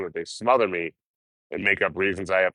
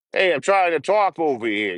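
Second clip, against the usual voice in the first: put-on New York accent; 10 semitones higher, 3 dB louder than usual. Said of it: "New Yorkers tell you".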